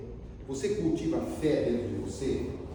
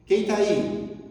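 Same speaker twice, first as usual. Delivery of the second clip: calling out; 6 semitones higher, 6 dB louder, much slower than usual. Acted. Monk calls through door.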